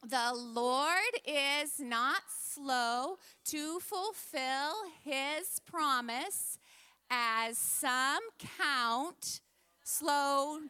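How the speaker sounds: slow, measured scripture recitation; group recitation. Real, not acted. Teacher leading children in a verse, slow, deliberate recitation. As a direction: stretch each word so others can follow. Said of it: "let's say it all together".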